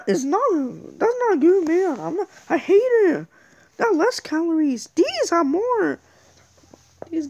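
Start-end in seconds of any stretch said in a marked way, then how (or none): none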